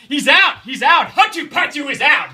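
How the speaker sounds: high, loud bird screech of words; little bird screeching alarm. A narrator's reading. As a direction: shriek it like a tattletale bird. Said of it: "it was screeching".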